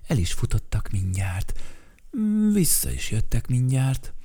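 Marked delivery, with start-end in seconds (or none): none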